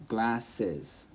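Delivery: GLASSES split into GLASS and iz; syllable by syllable. Shown as a demonstration. Teacher counting syllables, glasses broken in two. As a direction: say glasses in two separate pieces. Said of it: "How many syllables are there?".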